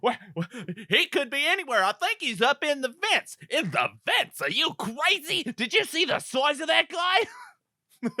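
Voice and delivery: high, scared cop voice that climbs to a shout; panicky cop. Acted. Cop argues his partner out of chasing the huge guy, in high rising alarm. Acting cops arguing.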